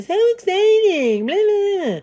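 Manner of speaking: high, drawn-out squeal of fake excitement; mock excitement. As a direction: gush mockingly, way up high. Mimics everybody making a fuss.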